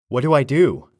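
loud, high, frantic call; calling to Gordon for instructions. Acted. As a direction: shout it across the room to Gordon. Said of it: "I called to Gordon".

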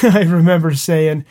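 ordinary talk

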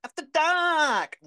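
high, loud, long-held "dark"; hammed-up sing-out; drawn-out catchphrase call. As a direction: belt out the show's name, stretching the last word. Drawn-out call of show's name.

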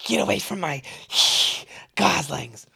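breathy mock goose voice with hisses; hissing goose. Goose guarding her goslings, mock hissing voice. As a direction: play the angry mother goose. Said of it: "they hiss so loudly".

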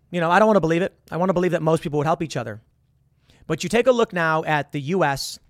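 ordinary talk, nothing marked

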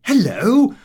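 loud, high greeting of surprise; sudden hello on the jetty; surprised hello. A narrator's reading. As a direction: call it out in surprise. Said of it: "I said".

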